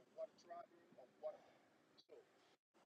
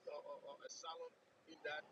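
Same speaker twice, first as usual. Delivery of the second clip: ordinary talk, nothing marked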